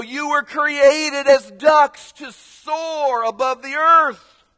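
high, loud preaching with stretched words; soaring, slow preacher cry; duck preacher. Acted. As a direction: draw it out like a rousing pulpit call. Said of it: "The duck preacher would go".

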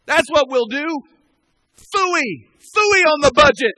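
high, very loud pulpit cry; exuberant shout. Real, not acted. Pastor defying the budget before his church, exuberant shout. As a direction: throw caution away and holler it to the back row.

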